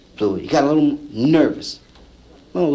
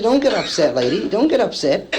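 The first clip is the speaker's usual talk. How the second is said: mock placating; 9 semitones higher than usual. Soothing the angry Lulu.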